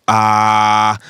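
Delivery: loud, held "aah" of hesitation; drawn-out filler "ah". Shown as a demonstration. Showing a forbidden pause in a lie, long hesitant "ah". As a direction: stall on a long, level "aah". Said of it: "or using".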